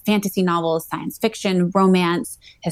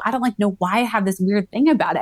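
ordinary talk

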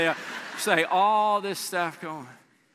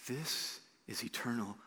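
quiet, lower, heartfelt close; tender appeal; 6 semitones lower, 10 dB quieter than usual. Acted. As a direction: comfort the lonely listener gently.

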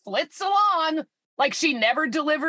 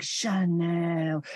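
celebrity ad purr; 6 semitones lower, 3 dB quieter than usual. Retold or imitated. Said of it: "Natalie Portman's like".

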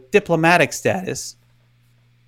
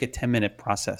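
normal talking voice

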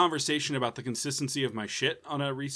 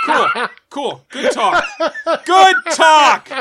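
laughing shout; 13 semitones higher, 14 dB louder, much slower than usual. Real, not acted. Sarcastic after interruption.

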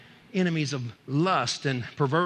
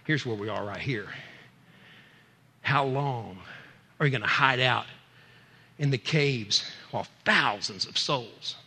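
low, hushed, weighty voice; slow solemn question, pitched low; Holy Spirit speaking; 4 semitones lower, slower than usual. Retold or imitated. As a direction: bring it down low and let each phrase land. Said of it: "Holy Spirit grabbed a hold of me and said".

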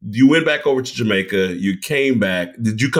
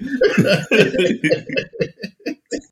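rising laugh; 10 semitones higher than usual. Real, not acted. Laughs at own joke.

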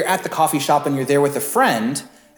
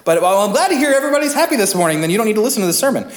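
much higher, fast, teasing voice; mock-gleeful joke; 8 semitones higher, 5 dB louder, much faster than usual. Pastor teasing the congregation, playful high quick voice. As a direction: jokingly dismiss the congregation. Joking that no one needs sermon.

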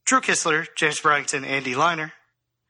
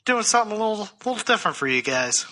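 muffled, hand over mouth; 6 semitones lower than usual. Real, not acted. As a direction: speak through your hand. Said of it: "That little muffled voice".

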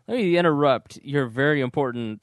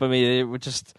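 usual speaking voice

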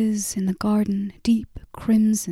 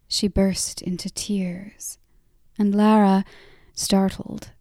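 plain reading voice

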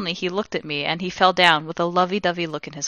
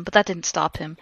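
ordinary delivery, nothing marked

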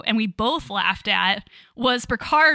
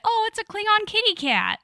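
mock Tasha; 10 semitones higher than usual. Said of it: "Tasha basically calls it".

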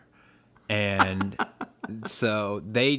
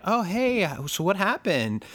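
lightly voiced, higher, breezy nurse; casual nurse; 9 semitones higher than usual. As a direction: play a curious nurse acting casual.

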